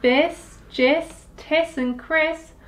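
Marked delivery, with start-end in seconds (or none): none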